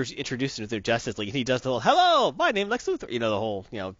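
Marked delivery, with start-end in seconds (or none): 1.8-3.1 high, loud hello in a bad Mandarin accent; hammy broken-English greeting; terrible Mandarin accent. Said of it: "terrible Mandarin".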